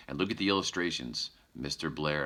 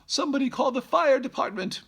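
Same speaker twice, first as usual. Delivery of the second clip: much higher, louder mother bear voice; 7 dB louder, faster than usual. Acted. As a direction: squeak it out like a fussy mother bear. Missus Blair voiced in story.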